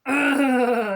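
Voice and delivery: long drawn-out "ugh" of disdain; disgusted groan. Acted. Council member groaning at bad grammar, disgusted groan. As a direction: groan it out in exasperation.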